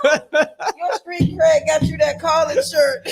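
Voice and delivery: laughing. Real, not acted. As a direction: tease while cracking up. Teasing, both cracking up.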